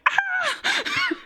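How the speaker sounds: high delighted squeal with laughter; excited squeal. Retold or imitated. Fan squealing at a romantic line. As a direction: squeal like a fangirl over the scene. Squeal at Ilya requesting him.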